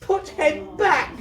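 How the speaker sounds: put-on very high, slow desperate child scream; little girl screaming at the man to undo it. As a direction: scream it out, begging. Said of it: "she screamed".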